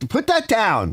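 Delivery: "Put that down" shouted high and sharp, pitch way up; retold yell. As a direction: order him to drop the saw, high and urgent. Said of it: "I yelled at him".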